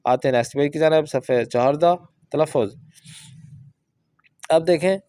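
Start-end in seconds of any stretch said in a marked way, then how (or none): none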